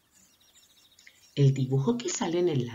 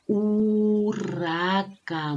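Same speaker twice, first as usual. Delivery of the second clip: very slow, higher "urraca" with a long U; stretched first vowel; 5 semitones higher, much slower than usual. Shown as a demonstration. Teacher naming pictures that begin with U, drawn-out "urraca". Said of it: "Todos ellos comienzan con la vocal".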